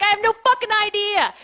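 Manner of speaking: high, loud retold scream; acted furious high yell. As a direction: scream it back mid-argument. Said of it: "And, like, screaming".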